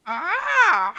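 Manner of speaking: high shrill yelp of alarm; startled yelp. Acted. GM voicing a startled sailor yelp. GM voices startled NPC cry.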